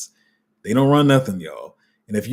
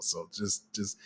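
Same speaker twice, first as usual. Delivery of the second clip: ordinary delivery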